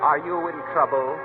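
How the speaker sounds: ordinary talk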